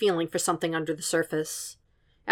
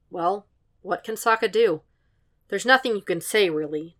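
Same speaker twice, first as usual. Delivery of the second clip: ordinary delivery, nothing marked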